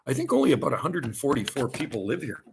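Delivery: usual speaking voice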